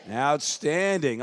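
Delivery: ordinary talk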